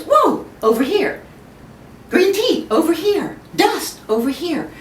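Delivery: a book shouting for attention; beckoning distraction. Retold or imitated. A book on the table calling to the speaker, high and loud. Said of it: "a book, goes".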